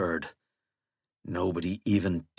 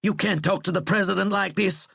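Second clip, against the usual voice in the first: raised, indignant snarl; angry snarl; 10 semitones higher, 4 dB louder than usual. A narrator's reading. Aide rebuking the general for insolence, snarling. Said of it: "snarled one of Flannery's aides".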